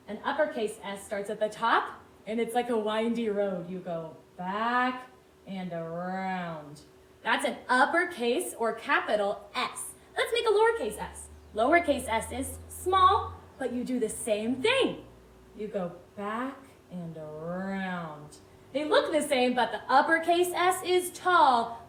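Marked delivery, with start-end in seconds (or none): 3.7-6.8 slow and low, stretching the words; softer, lower, following the pencil round; drawn-out tracing. Shown as a demonstration. Voicing the path of tracing. 15.6-18.4 slow, soft and low; hushed, lingering trace of the letter; drawn-out tracing. Shown as a demonstration. Voicing the path of tracing.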